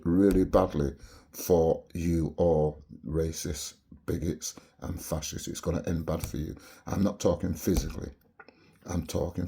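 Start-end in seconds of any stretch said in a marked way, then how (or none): none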